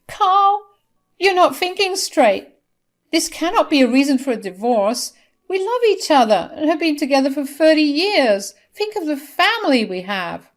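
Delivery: high, loud tearful pleading; distraught wife wailing her appeal; crying plea. A narrator's reading. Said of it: "She cried and said".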